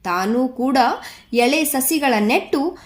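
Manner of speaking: put-on measured, lilting poem reading for children; poem recitation. Teacher reads the Putti poem to grade six children, lilting recitation. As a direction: recite each verse line in rhythmic phrases.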